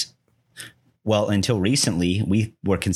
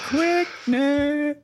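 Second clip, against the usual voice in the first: squeaky high echo. Pretend. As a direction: shoot way up into a squeak. Echoes word in high voice.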